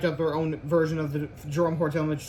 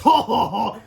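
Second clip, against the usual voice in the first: loud, higher villain ho ho; evil laugh; 5 semitones higher, 9 dB louder, slower than usual. Acted. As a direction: cackle like a cartoon villain.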